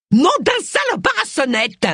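juron furieux, très aigu; juron sifflé. A narrator's reading. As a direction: crache l'insulte avec colère, voix perchée. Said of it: "siffla-t-elle". (colère criée dans l'aigu)

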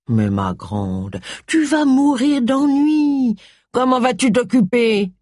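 slow, lightly voiced Charles; slow mocking concern; Charles teasing. A narrator's reading. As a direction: drawl it out like a fond old friend.

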